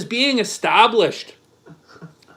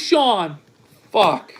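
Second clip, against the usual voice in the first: ordinary talk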